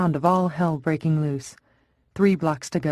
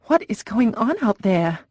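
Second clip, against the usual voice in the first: higher, alarmed cry; frightened cry; 6 semitones higher than usual. Acted. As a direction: cry out in fear at the radio. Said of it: "Tess cried".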